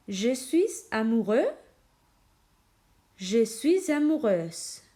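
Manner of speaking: slow, carefully pronounced model phrases; repeat after me. Shown as a demonstration. Teacher models phrases for students to repeat, slow and careful. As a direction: model each phrase slowly for the class to echo. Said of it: "Répétez avec moi".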